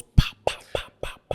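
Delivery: voiceless popping noises; sand hitting sounds. Retold or imitated. Describing the sandstorm to his friend, voiceless pops. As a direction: pop the lips like sand pelting you.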